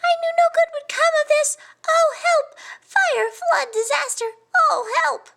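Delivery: very high-pitched alarmed squeal; tiny squeaky shouting. A narrator's reading. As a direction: shout in panic like a tiny creature. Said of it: "it squeaked".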